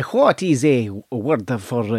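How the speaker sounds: put-on very slow, searching Spanish accent. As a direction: drag out the words in mock Spanish accent. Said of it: "he pretended he was Spanish".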